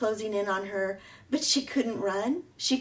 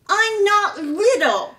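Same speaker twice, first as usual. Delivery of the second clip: snapped; 12 semitones higher, 12 dB louder, slower than usual. A narrator's reading. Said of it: "snapped Thorn under his breath".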